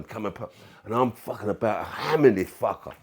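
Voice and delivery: normal talking voice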